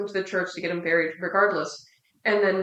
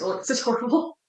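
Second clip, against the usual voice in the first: laughing through an apology; 5 semitones higher than usual. Real, not acted. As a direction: say it through a guilty laugh, voice rising. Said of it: "I'm laughing".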